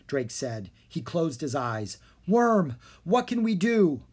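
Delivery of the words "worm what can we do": desperate plea. A narrator's reading.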